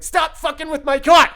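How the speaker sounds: full-out high, very loud yell. Retold or imitated. Yelling at the voices messing with the car, loud angry shout. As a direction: yell angrily at your tormentors. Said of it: "So I'm yelling at the voices like".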